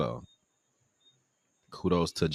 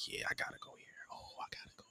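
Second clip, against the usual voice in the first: breathy near-silent, very high; hushed gotta-go-here whisper; awed whisper; 22 dB quieter, faster than usual. Retold or imitated. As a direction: whisper it in awe. Awed decision at website.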